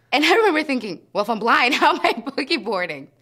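laughs through the words, high and loud; giggling at how silly it was; laughing. Real, not acted. Recalling the blind-girl boogie boarding, laughing.

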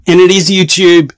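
loud, pushed video voice; shouted presenter patter; overloud. Acted. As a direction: blast it out far too loud. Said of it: "turn your fucking audio down".

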